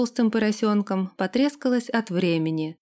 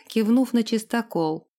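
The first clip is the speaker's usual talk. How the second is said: usual speaking voice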